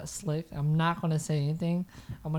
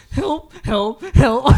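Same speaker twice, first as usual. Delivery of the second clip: mock cry for help; 9 semitones higher, 14 dB louder, much slower than usual. Mock call for help while nudging.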